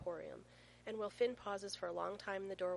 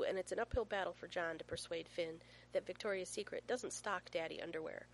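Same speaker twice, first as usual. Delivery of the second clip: ordinary delivery, nothing marked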